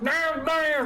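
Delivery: high, hammed-up garbled Creole drawl; Creole gibberish impression. Mocking how they turn to you, Creole gibberish. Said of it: "It's all gibberish".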